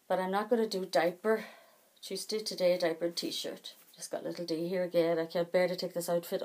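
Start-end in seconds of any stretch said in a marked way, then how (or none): none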